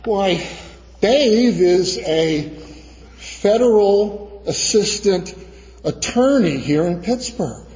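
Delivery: slow, word-by-word retold explanation. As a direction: spell it out slowly, pausing between phrases. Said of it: "he said very slowly".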